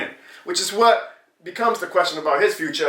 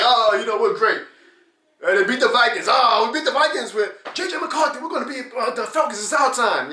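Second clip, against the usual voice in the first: higher, hammed-up hype; mock delusional Falcons fan; 7 semitones higher than usual. Vlogger mocking Falcons fans' hype, higher mock voice. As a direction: gush like a deluded rival fan. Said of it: "Falcons fans continue to still be like".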